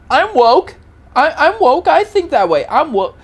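high, loud imitation of smug declaring; hammed-up show-off; mock self-righteous boaster.

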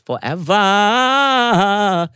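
high, long-held last syllable; theatrically drawn-out. Real, not acted. Ending the story of a cherished moment, theatrically drawn out. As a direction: stretch the word out grandly as it climbs.

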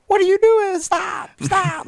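very high, loud alarmed shouting; passersby yelling. Retold or imitated. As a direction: yell like a shocked stranger on the street. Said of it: "like, yelling at both of us".

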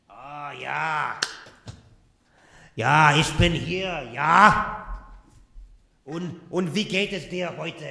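speaking German in character; German-speaking gruff guy. Acted. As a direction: Hans in German. English, please.

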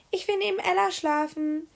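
put-on a girl calling out eagerly; calling out, child Tina. A narrator's reading. Tina calling out to the teacher, high and eager. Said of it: "rief Tina".

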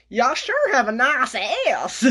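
slow, drawn-out Southern drawl; Southern accent hammed up to tease a friend. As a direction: lay on a lazy Southern accent. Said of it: "That's my southern accent".

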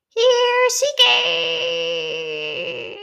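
loud, very high drawn-out call; long high holler. Pretend. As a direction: sing out as if calling a pet. Calling C K back out.

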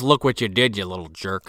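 low-key retold telling-off, trailing down; understated mock scolding. Father scolding the kid, understated mock scolding. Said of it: "He just goes".

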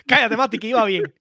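laughing scold. Real, not acted. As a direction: laugh while telling him off. Laughing at the interruption.

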